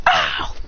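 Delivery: sudden low grunting ow; a startled pained yelp. Acted. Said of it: "accidentally steps on it".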